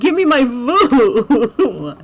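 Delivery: mock fury at the agent's thanks, half laughing; angry.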